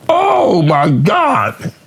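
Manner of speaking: long, high, drawn-out disbelief; retold shock. Recalling his reaction to the crazy trailer, drawn-out shock. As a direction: stretch it out, high and aghast.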